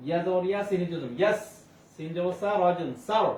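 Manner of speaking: normal talking voice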